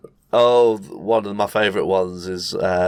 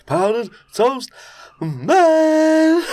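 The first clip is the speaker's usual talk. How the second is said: much higher, louder sung-out hero name; hero announcement; 4 dB louder, much slower than usual. Retold or imitated. Naming Powdered Toast Man to friends, higher heroic call. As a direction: herald him like a superhero.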